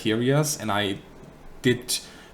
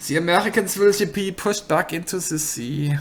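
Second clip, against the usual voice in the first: put-on higher, theatrical German accent as a game voice actor; mock German accent; 9 semitones higher, 4 dB louder than usual. Mocking a game's German voice acting, heavy German accent. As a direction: boom it out like a German commander in a war game. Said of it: "I felt the German accent in that campaign".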